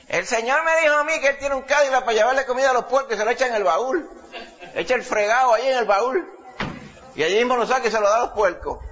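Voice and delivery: high, laughing through the punchline; laughing old man's voice. Retold or imitated. Old man retelling the Lord's Cadillac joke, high laughing voice. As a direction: chuckle the words high like the tickled old man. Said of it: "hablaba con esa voz".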